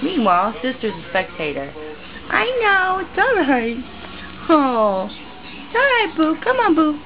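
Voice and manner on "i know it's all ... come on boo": high soothing pet talk; calm the upset cat sweetly. Real, not acted.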